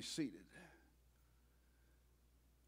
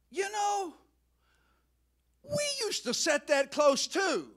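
a lightly voiced higher wife, calling out wistfully; wife's wistful voice, pitched up; 9 semitones higher than usual. Preacher voicing Ethel to her husband in the car, higher and wistful.

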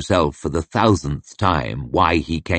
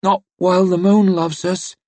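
muttered; 10 semitones higher than usual. A narrator's reading. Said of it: "muttered his sister".

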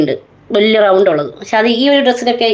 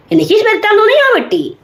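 high, quick protest; defiant retort; 9 semitones higher, faster than usual. Real, not acted. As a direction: throw it back at the critic, pitch jumping up. Defiant retort about her haircut. (ധിക്കാരത്തോടെ, ഉയർന്ന സ്വരത്തിൽ)

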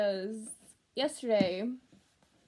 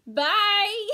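high, loud bright goodbye; cheerful shout; 10 semitones higher, 9 dB louder than usual. Real, not acted. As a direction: call out bye with a burst. Cheerful sign-off to viewers.

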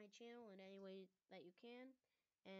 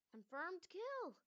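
excited announcement; 8 semitones higher, 8 dB louder, slower than usual. Acted. First victory announced.

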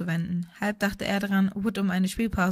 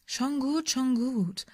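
hohes, gedehntes Abwiegeln; höher gesprochen, genervt einlenken; beschwichtigend; 5 semitones higher, much slower than usual. A narrator's reading. Kommentator wiegelt nach Verwarnung ab.

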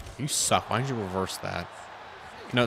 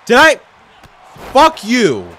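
high, loud indignant yell; angry outburst; 10 semitones higher, 11 dB louder, slower than usual. Real, not acted. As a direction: snap loudly at the opponent. Outraged at game opponent.

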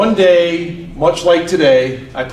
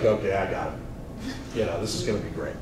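scheming grandpa; 8 semitones lower, 11 dB quieter than usual. Pretend. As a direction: plot the prank under your breath.

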